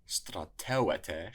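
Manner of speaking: Strateuete with the third-last syllable teu punched; Strateuete, accented on teu; stress on the antepenult. Shown as a demonstration. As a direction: lean slowly on teu in Strateuete. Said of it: "should have an accent on the antepenult".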